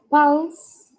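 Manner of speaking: "pulse" with an open A, as "pals"; vowel like A. Shown as a demonstration. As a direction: model "pulse" slowly with Spanish A for the u. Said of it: "como si fuese una A". ("pulse" pronunciado con A, como "pals")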